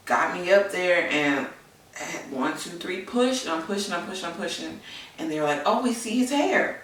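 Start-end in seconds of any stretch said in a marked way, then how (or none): 5.7-6.8 high, bright retold nurse exclamation; excited announcement. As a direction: relay the nurse's surprise, lifted high. Nurse announces baby's hair.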